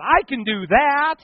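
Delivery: high, loud, drawn-out exclamation; eager realization. Retold or imitated. Young man hearing a testimony in church, eager outburst. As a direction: burst out slowly and loudly with eager confidence. His eager thought, retold.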